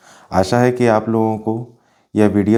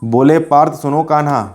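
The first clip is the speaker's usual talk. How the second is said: higher-pitched, ringing epic verse; poem recitation; 4 semitones higher than usual. Acted. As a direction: declaim the battlefield poem in rhythmic phrases.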